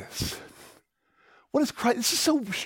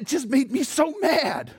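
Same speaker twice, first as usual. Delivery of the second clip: mock mad; 7 semitones higher than usual. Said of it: "She said".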